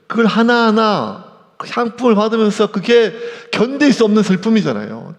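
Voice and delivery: ordinary talk, nothing marked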